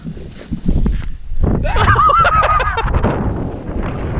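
loud high laughing burst; burst of laughter. Real, not acted. Angler laughs as his friend lands the fish. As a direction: crack up at the catch.

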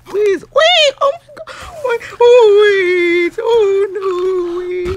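very high, squealing wail of fright; frantic, high-pitched cry; panicked pleading. Real, not acted. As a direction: shriek and beg for it to stop. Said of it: "it's too much".

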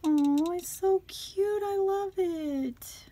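high, loud, stretched-out cooing delight; touched, near tears. Real, not acted. Crafter thanking friend for her gift, near-tearful gushing. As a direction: gush it slowly, truly overcome. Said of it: "you're gonna make me cry again".

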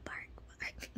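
breathy whispered puppy bark; tiny voiceless bark, barely there. Pretend. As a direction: whisper the woof, no voice at all. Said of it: "It's so adorable".

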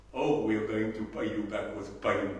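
ordinary talk

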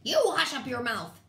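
loud, angry royal rebuke; howled order. A narrator's reading. Turtle king hushes lowly complainer, howling. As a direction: howl down the complainer. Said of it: "howled the mighty King Yertle".

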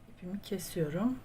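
normal talking voice

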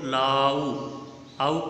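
"लाउ, आउ" drawn out slowly; au sound. Shown as a demonstration. Teacher points out the shared sound, au stretched in "लाउ, आउ". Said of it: "का sound आ रहा है".

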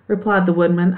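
usual speaking voice

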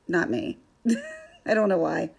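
usual speaking voice

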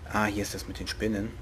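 normal talking voice

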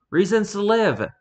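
high, bright delivery of the caption; excited. Acted. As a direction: cheer it out like a battle cry. Reads meme caption, enthusiastic.

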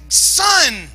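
put-on high, urgent warning to a child; hammed-up parent scolding a son; alarmed parent. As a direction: call out a warning, then short bursts. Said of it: "Be like".

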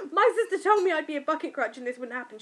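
mock indignation at sister; laughing complaint. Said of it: "I was like".

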